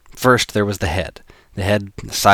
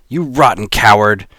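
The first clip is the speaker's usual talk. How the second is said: louder angry outburst at the villain; 2 semitones higher, 6 dB louder than usual. Acted. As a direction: spit the insult out. Jason confronts the boss.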